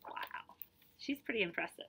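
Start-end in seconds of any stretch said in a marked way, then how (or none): none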